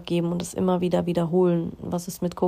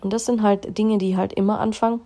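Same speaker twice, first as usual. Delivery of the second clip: usual speaking voice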